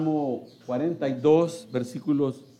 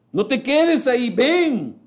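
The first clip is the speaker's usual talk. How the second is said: urgent call; 6 semitones higher, 4 dB louder than usual. Acted. Preacher urging listeners.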